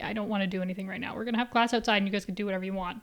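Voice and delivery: rushed, careless teacher voice; fast lazy teacher. Retold or imitated. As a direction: rattle it off like a bored teacher. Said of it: "the teachers being like".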